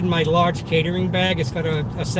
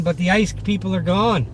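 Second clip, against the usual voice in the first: ordinary delivery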